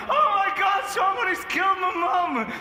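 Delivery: high, loud hammed-up panic; mock horrified shout. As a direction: scream it as if finding mum's head. Acted horror at mum's head.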